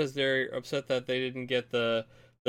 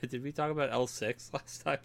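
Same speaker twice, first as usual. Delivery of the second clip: trying to ask through a laugh; 6 dB quieter than usual. Real, not acted. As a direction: hold back laughter while asking. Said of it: "I can't say without laughing".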